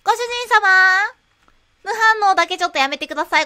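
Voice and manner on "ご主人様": high, loud maid cafe welcome; sugary maid cafe greeting. Pretend. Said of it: "ちょっとメイド風なコンビニになってますよ".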